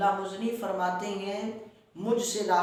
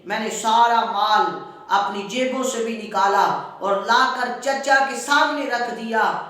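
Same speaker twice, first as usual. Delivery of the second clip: usual speaking voice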